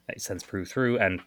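ordinary talk, nothing marked

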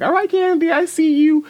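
very high-pitched, hyped-up approval; impressed cheer. Retold or imitated. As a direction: squeal it like cheering on a performer. Voicing her impressed reaction to Candy.

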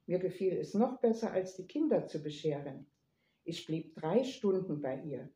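plain reading voice

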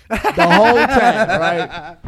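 breaking into laughter mid-line; can't keep a straight face; chuckling through the words; laughing. Real, not acted. Laughs while telling story.

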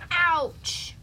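sudden high, loud storybook yelp; pained exclamation. Acted. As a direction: cry out as if you just hit the ground. Falls from tree with thud.

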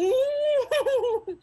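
high, loud "ooh"; excited whoop. Real, not acted. Player reacting to the game master, whooping.

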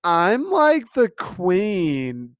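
high, drawn-out snooty queen voice; mocking Victoria. Podcaster mocks Victoria's voice, slow snooty imitation. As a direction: stretch it out like a spoiled queen. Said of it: "The way she talks".

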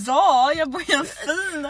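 a posh Stockholm accent, hammed up and drawn out; mock posh Stockholm. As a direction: play a snooty posh Stockholm girl. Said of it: "Lät som att jag var från".

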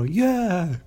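a hint of a Jersey brother; lightly voiced tough guy. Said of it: "It was like".

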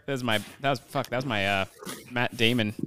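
ordinary talk

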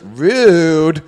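loud, drawn-out auntie scolding; disapproving auntie. Acted. Auntie reacting to a burp at the table, drawn-out scolding. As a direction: stretch it out like a disapproving aunt. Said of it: "Rude is something your auntie says".

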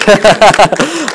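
a bright, rising laugh of delight; high happy chuckle; pleased laugh. Real, not acted. Congregation reacts, preacher laughs.

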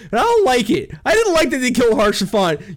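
ordinary delivery

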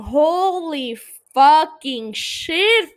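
loud, high, slow cry of disbelief; real overwhelmed exclamation; stunned outburst. Real, not acted. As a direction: blurt it out, blown away. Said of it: "I got all emotional".

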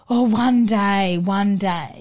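drawn-out, emotional put-on longing; slow, heavy emphasis on "one day"; over-emotional. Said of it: "all that emotion and that real".

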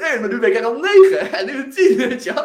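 high, loud, laughing through the words; giddy with his own progress; bursting with laughing pride at each new score; laughing excitement. Retold or imitated. Inner voice rating himself higher.

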